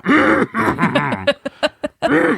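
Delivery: high, drawn-out mock nervous sputter; anxious hinting noise. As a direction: make high, panicky noises as a hint. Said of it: "trying to give him every hint".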